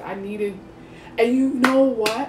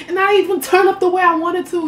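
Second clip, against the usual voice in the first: higher and louder, venting; frustrated complaint; 9 semitones higher, 6 dB louder than usual. Retold or imitated. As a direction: complain it out loudly. Quoted inner complaint.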